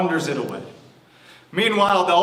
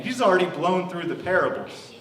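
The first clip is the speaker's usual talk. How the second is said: normal talking voice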